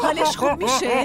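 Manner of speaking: alarmed. Acted. Panicked exchange.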